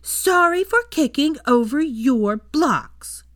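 put-on loud, high, drawn-out child's shout. A narrator's reading. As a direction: shout each word slowly, high-pitched. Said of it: "and he shouted".

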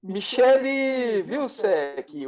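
drawn-out winner reveal. Acted. Reading out the winner's name.

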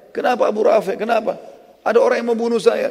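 disguised voice. Retold or imitated. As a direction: fake a frightened call for help, pitched well up. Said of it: "Saya ubah lagi suara saya". (suara yang disamarkan, jauh lebih tinggi, panik)

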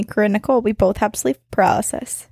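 ordinary delivery

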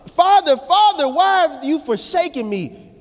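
loud high anguished cry; preacher voicing Jesus's anguished cry; hollering out. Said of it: "he hollers out".

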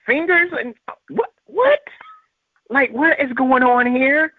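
high, stunned disbelief; what is going on, shocked; baffled surprise. Retold or imitated. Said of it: "And then you said".

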